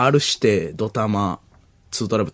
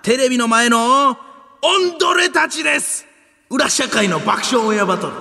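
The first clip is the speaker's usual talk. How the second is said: hint of a yakuza host, higher; gangster announcer; 9 semitones higher than usual. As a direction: lightly play a thuggish MC. Reading a letter in gangster tone.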